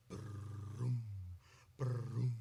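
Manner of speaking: low rolled "brum"; imitated drum sound. Shown as a demonstration. Host showing how the mallets sound, low imitated drum. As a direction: mimic a drum's deep resonant roll. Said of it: "it makes a very nice sound".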